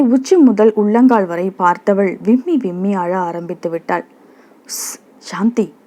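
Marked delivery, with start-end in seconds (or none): 4.7-5.7 hushing. Acted. Hushing a sobbing woman in street.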